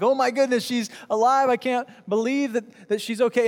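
mock a crowd's astonished excitement; freaking out. Onlookers seeing the girl alive, freaking out. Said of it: "Everybody's freaking out".

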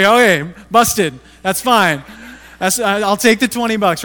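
busted, taking the cash with a loud sheepish laugh; sheepish give-in. Retold or imitated. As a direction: throw up your hands and give in, loudly. Caught out, gives in laughing.

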